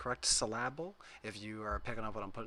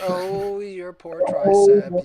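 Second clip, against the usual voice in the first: drawn-out, much louder mock pity; mock sympathy; 6 semitones higher, 17 dB louder, slower than usual. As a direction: pity him over the top, slowly. Teasing Craig about cramp.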